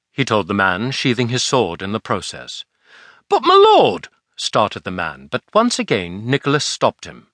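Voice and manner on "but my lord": much higher, louder protesting cry. Acted. Tide waiter protesting to his lord, high and loud. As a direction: object in shocked disbelief. Man protests Nicholas's order.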